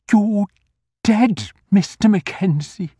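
usual speaking voice

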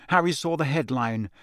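usual speaking voice

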